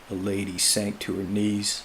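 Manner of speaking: plain reading voice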